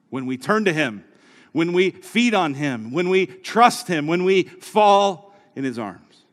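rising sermon cadence; preaching build. Acted.

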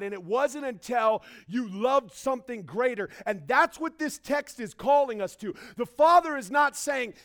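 loud, driving sermon build; full-force preaching. Acted. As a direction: preach it hard to the congregation.

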